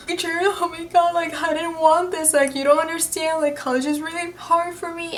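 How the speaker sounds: high, soft tearful whimper, hammed-up; imitated crying. Student pleading with her teacher, mock crying. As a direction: plead through mock sobs. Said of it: "crying, like".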